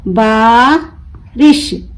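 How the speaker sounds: बारिश split slowly, long बा, pause, रिश; broken into syllables. Shown as a demonstration. Teacher breaking the word for learners, बारिश in two slow pieces. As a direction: stretch the बा of बारिश and detach रिश. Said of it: "तोड़ तोड़ कर पढ़ूंगी".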